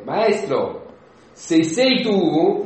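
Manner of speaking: loud, drawn-out appeal to the master; impetuous Peter. Acted. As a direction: call out boldly, stretching the words. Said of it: "nella sua irruenza". (tono irruente)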